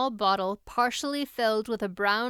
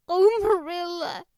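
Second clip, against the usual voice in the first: high, mildly tearful wail of a name; sobbing; 8 semitones higher, 7 dB louder than usual. Acted. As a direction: cry out her name, near tears. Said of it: "sobbed Anne".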